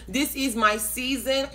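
slow, spaced-out proclamation; slow declaration. Real, not acted. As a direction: dictate it slowly for people to type. Dictating a declaration to type.

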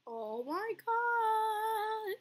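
very slow, high held notes; drawn-out exclamation. Real, not acted. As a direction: stretch every word out in a high voice. Reacting to game in play.

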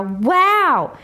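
plain reading voice

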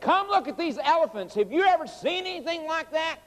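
high-pitched, acted-out amazement; excited schoolchildren calling. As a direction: call the teacher over like an amazed kid. Retold excited children calling teacher.

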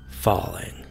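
ordinary delivery

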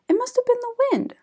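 quick reassuring parents; parents' voice. Acted. Parents dismiss worry.

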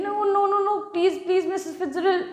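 high, pleading no no no; high-pitched pleading protest; flustered protest. Acted. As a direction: beg her to stop, flustered. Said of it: "ऐसे कहते हुए कहती है".

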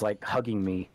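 ordinary delivery

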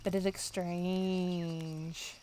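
long, lingering drawl on the last word; drawn-out musing. Real, not acted. Player puzzling over Lara's odd look, drawn-out musing. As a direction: stretch the words out very slowly.

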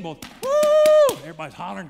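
very high, loud whoop; crowd cheer. Retold or imitated. Preacher imitating the crowd whooping for the walker.

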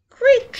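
a high loud creaking bed. A narrator's reading. Bed sound effect.

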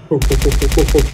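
loud hammed-up ho ho ho; hearty mock Santa ho ho; mock Santa laugh. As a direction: boom out a fake jolly laugh. Mock Santa laugh while gaming.

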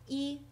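一 held high and level; first tone. Shown as a demonstration. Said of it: "it's usually first tone".

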